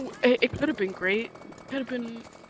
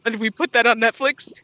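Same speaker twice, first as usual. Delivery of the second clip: loud rising question in disbelief; incredulous; 9 dB louder than usual. Acted. Fan questioning the show's writers, loudly incredulous. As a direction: punch it out loudly, as if appalled.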